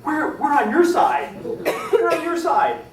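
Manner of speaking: high, loud, slow insistent plea; pleading. Retold or imitated. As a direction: beg them to believe you, drawn out. Pleading with naval officers.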